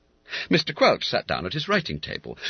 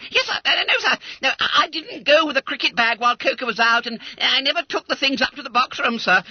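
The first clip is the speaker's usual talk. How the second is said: very high, loud and fast; frantic babbling; 5 dB louder, faster than usual. Acted. Boy blurting his confession to the master, high fast panic. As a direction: gabble it out in panic.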